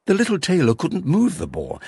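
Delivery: usual speaking voice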